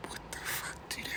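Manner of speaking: whispered aside. Real, not acted. Aside reacting to letter.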